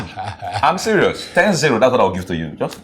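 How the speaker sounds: high, loud bragging; boastful trash talk. Acted. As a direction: taunt him with the score.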